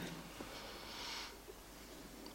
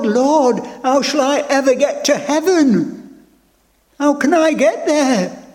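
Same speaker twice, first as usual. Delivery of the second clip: higher, pleading cry to the Lord; anguished prayer; 6 semitones higher, 4 dB louder than usual. Retold or imitated. Preacher reliving his plea to the Lord, anguished. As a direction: beg for help, half retold.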